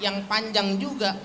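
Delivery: normal talking voice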